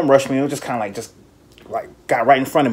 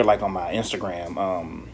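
ordinary talk, nothing marked

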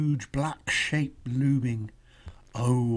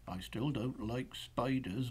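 usual speaking voice

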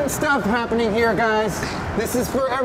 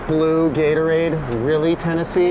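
disgusted; 6 semitones lower than usual. Real, not acted. Mocking the blue drink.

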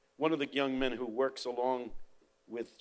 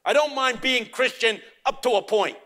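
accusing yell, louder; angry father blaming the teacher, louder and higher; 11 semitones higher, 10 dB louder than usual. Retold or imitated. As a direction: yell it at the professor. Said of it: "He said".

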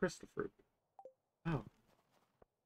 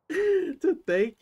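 squeaky slip; 6 dB louder than usual. Acted. Stumbling over Gunther's line.

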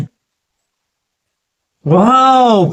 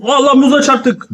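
usual speaking voice

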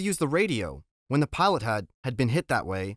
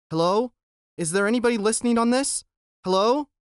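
anxious calling out; 8 semitones higher, 4 dB louder than usual. Acted. Boy calling into plane radio.

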